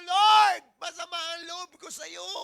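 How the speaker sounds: sulking believer shouting hurt feelings at the Lord; shouted complaint. Shown as a demonstration. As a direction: Lord shouted out, then a loud aggrieved cry. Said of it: "nagtatampo ka sa Kanya".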